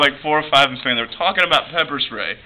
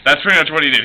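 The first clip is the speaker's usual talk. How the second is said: ordinary talk, nothing marked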